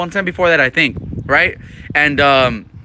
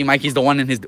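usual speaking voice

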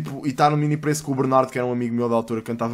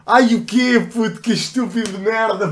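shocked outburst; 7 semitones higher, 6 dB louder than usual. Real, not acted. Reacting to a video.

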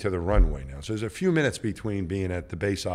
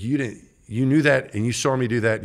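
louder, higher reproachful outburst; upset reproach; 4 semitones higher, 5 dB louder than usual. Retold or imitated. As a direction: let the pilot's alarm spill into scolding. Pilot scolds him after near-miss.

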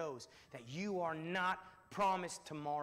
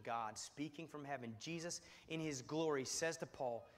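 ordinary delivery

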